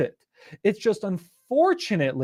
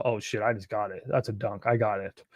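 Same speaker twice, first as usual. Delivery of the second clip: quiet glee; 9 semitones lower, 8 dB quieter than usual. Retold or imitated. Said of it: "Then you're like".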